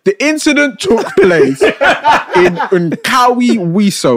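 drawn-out place name through laughter; slow careful reading. Real, not acted. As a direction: stretch out Nkawi Wiso slowly, laughing. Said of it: "It took pla".

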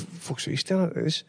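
under-the-breath whisper; muttered whisper to self. Retold or imitated. Thinking while stared at.

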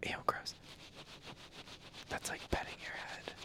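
hushed ASMR whisper. Acted. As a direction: keep it a soft whisper.

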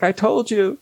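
high mock Wendy, self-assured; imitating Wendy. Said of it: "She's like".

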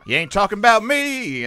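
high, loud hammed country singer toast. Comic spoofs a proud boyfriend in a bar, hammed country toast. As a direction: raise a glass and belt it, laughing. Toasting like a [name] video.

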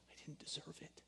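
hushed whisper, voicing his crying daughter; retold tearful whisper. Father retelling his daughter's words after the film, in a hushed whisper. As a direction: breathe it out softly as the child. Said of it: "she's bawling".

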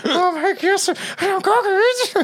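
high, laughing creepy granny; creepy old hag. Pretend. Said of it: "the creepiest voice".

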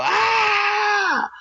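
long, high-pitched mock scream; high held war cry, not at full volume; imitated war-face yell. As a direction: let out a sustained high "aah" like a distant yeller. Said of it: "I hear somebody yell".

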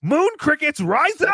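loud, high call to rise up; rallying cry. Acted. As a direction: rouse the crowd like a hype man. Rallying chant to the chat.

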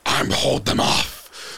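loud, drawn-out rough yell; strained battle shout. Acted. Brother facing the attackers alone, strained shout. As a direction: force out each word slowly and loudly.

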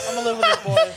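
very high squeaky mock, laughing. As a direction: squeak the line while laughing. High mocking repeat.

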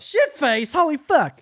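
loud, high burst of glee; loud high surprised cry; excited outburst. Real, not acted. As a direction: blurt it out delighted. Echoing insult, amused.

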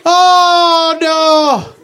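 starving Irish peasant. Acted. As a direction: ham up the dying famine victim begging for potatoes. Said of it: "it was like a little play".

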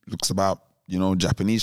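ordinary delivery

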